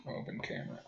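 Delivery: whispered "Open camera"; muttered to himself at the laptop. Retold or imitated. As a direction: breathe it out under your breath. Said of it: "I'm like".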